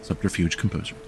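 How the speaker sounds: "Subterfuge, composure" said softly in the player's native accent. Shown as a demonstration. Game master repeats the roll for a player, in that player's accent. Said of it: "Thank you for saying it in my native accent".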